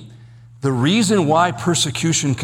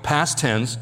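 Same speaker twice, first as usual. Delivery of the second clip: normal talking voice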